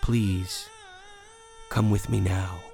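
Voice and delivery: low, calm, gentle request; unhurried, serene invitation. A narrator's reading. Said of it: "and said serenely".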